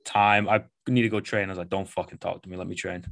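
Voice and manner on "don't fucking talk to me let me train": hushed, dropped-volume grumble. Retold or imitated. Coach waving people off before training, softly. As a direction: say it softly, under the voice. Acted aside, wanting to be left alone.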